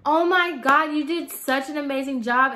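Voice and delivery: high, gushing fake-nice voice; fake sweet customer. Customer flattering the stylist in front of mom, fake sweet. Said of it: "she was like".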